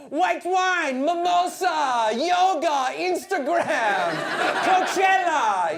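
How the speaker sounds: high, loud, drawn-out mock-girl cries; American girls impression. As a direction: squeal each word slowly like a party girl. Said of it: "American girls are like".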